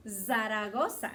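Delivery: "Zaragoza" with a Spanish tapped r, zah-rah-GO-sah; Spanish accent. Shown as a demonstration. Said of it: "saying it a little with the Spanish accent".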